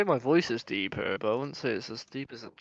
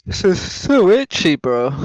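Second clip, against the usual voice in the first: blurted out loud and sharp, pitched up; loud complaint; 5 semitones higher, 14 dB louder than usual. Real, not acted. Gamer friend loudly complaining on voice chat. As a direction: grumble it out loud like it's driving you mad.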